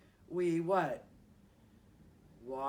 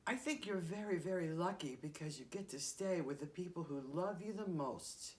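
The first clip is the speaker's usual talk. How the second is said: ordinary delivery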